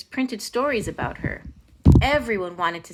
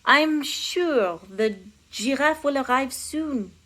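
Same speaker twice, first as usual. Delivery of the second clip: put-on higher, slow, hesitant French-accented reassurance; nervous counselor; 5 semitones higher, slower than usual. A narrator's reading. Counselor placating the king, nervous French accent. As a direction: stall nervously in mock French. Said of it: "the counselor said nervously".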